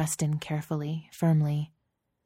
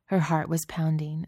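usual speaking voice

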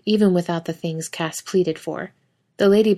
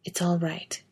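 whisper; 6 dB quieter than usual. A narrator's reading.

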